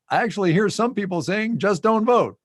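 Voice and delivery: high mock quote of Pence; quoting Pence. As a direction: imitate Pence raising his voice. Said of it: "this is a quote".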